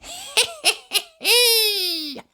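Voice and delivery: very high sly giggle; high chuckle. Acted. Missus Washer chuckling over her trick, very high. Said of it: "Missus Washer chuckled to herself".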